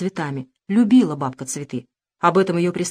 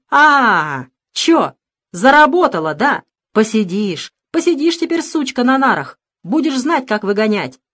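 loud jeering yell, higher; 5 semitones higher, 7 dB louder than usual. Acted. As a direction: scream it at her from the doorway. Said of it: "завопив с порога".

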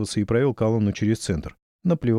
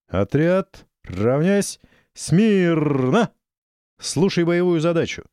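higher, louder drill commands; booming command voice; 7 semitones higher, 4 dB louder, much slower than usual. Acted. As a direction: bark the commands across a parade ground. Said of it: "А голосище у майора всё-таки, будь здоров".

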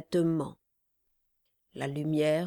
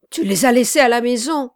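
loud, fast, higher accusing cry; cried out; 5 semitones higher, 10 dB louder, much faster than usual. A narrator's reading. Charlot blaming the man over missing slippers, crying out. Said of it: "cria-t-il".

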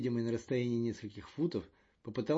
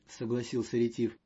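ordinary delivery, nothing marked

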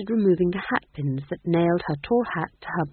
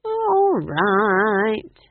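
high, loud, drawn-out concession; long-drawn reluctant agreement; relenting a bit; 7 semitones higher, 7 dB louder than usual. A narrator's reading. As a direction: give in grudgingly, stretching the words. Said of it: "relenting a bit".

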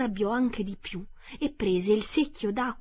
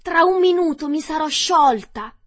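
terrified wail; 9 semitones higher, 10 dB louder than usual. Acted. Witch melting in terror.

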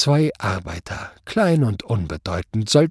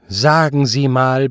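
slow, louder lead-in; loud, condescending judge; scornful opener; 3 semitones higher, 6 dB louder, slower than usual. A narrator's reading. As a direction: draw it out in exasperation. Judge resumes his scornful questioning.

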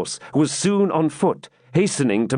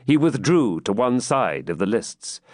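ordinary delivery

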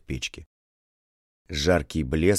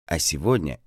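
ordinary delivery

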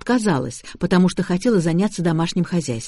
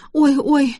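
crying on the phone; 8 semitones higher than usual. A narrator's reading. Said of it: "плакала девушка".

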